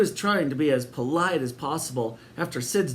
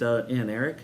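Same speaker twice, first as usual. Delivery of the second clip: ordinary delivery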